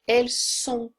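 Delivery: "Elles sont" with a hard s on "sont", no z; crisp s sound. Shown as a demonstration. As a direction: hit the s of "Elles sont" cleanly. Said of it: "Som de S".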